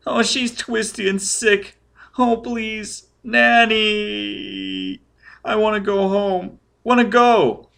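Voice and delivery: put-on high, pleading child wail; shrieking child. A narrator's reading. Scared child begging to go home, high wailing. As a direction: wail for nanny like a terrified little boy. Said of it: "The child was shrieking and shaking".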